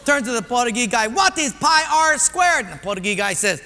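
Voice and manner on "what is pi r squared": high, loud, slow delivery; raised quiz question. Acted. Personnel guy asks the Portuguese guy, slow and loud. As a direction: put the question slowly and loudly. Quizmaster repeats question to favourite.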